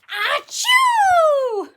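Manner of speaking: very loud, high, drawn-out sneeze; hammed-up bellowing achoo; huge mock sneeze. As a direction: explode into a storybook bear's sneeze. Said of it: "He blows and he sneezes".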